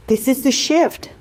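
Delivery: ordinary delivery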